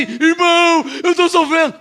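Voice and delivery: high, drawn-out wail of self-pity; exaggerated woe-is-me cry; mock suffering. Shown as a demonstration. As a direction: imitate a believer moaning about hardship. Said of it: "Ao invés de você falar assim".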